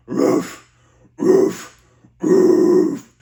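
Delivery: barked scream. Shown as a demonstration. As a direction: bark the word like a dog. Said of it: "I like to use the word rough".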